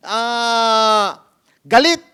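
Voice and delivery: a sharp loud yell in the middle of a scolding; sudden loud outburst. Retold or imitated. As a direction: blurt out the word in a loud burst. People scolding the quarrelsome man.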